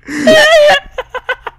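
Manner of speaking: high loud laugh mixed with a wail; laugh-cry over your defeat. Real, not acted. Laughs at own failure. (risada chorosa e aguda)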